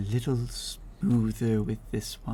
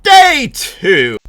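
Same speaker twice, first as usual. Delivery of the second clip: excited shout; 10 semitones higher, 13 dB louder than usual. Real, not acted. Day announcement, shouted intro.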